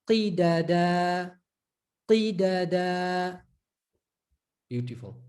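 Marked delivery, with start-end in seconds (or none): 0.1-3.4 Qi da da said very slowly, syllable by syllable; teacher drilling Qi da da. Shown as a demonstration. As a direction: stretch out Qi da da.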